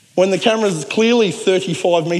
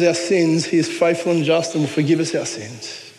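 usual speaking voice

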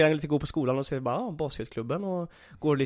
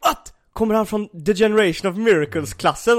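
pitched-up, louder amazed question; stunned disbelief; 7 semitones higher, 5 dB louder than usual. Retold or imitated. Teammates reading the sign-up sheet, stunned and pitched up. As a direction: gasp it out like you can't believe the paper.

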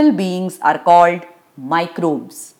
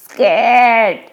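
scared. A narrator's reading. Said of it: "says Madhav".

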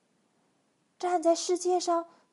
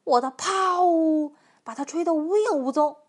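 loud elephant bragging; big, blustering storybook elephant; bellowed boast; 2 semitones higher, 9 dB louder than usual. A narrator's reading. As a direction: trumpet the sound word, then crow. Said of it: "大象大吼道".

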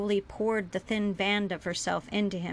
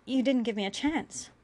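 usual speaking voice

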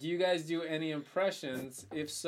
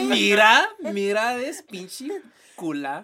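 put-on loud, slow Mexican accent, far from his own; hammed-up Mexican street voice; 6 semitones higher, 11 dB louder, much slower than usual. Scolding a woman in a hammed-up Mexican accent.

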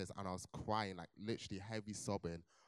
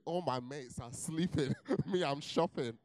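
gleeful thought; 6 semitones higher, 8 dB louder than usual. Retold or imitated. Gleeful retold thought while shopping.

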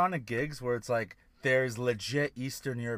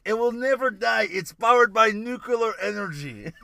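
put-on Russian accent; 7 semitones higher, 7 dB louder than usual. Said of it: "It's like".

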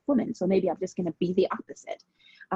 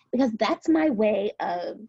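ordinary talk

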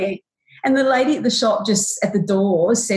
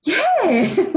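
high, forced cheerful yeah; fake happy; 11 semitones higher than usual. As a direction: pretend to be happy for the kids. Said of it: "I just pretended I was happy too".

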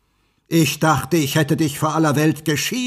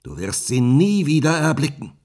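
scornful witch; 7 semitones higher, 8 dB louder than usual. Acted. The witch taunts the prince that Rapunzel is lost, loud and mocking. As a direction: relish crushing his hopes. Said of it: "rief sie höhnisch".

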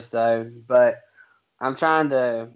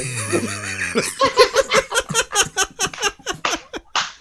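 high giggle. Real, not acted. Laughing at own joke.

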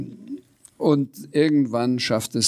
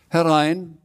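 higher-pitched, moderate call from inside; 8 semitones higher, 4 dB louder than usual. A narrator's reading. Frau Rotkohl answering a knock, restrained call. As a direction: call a visitor in, not too loud. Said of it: "rief sie von drin".

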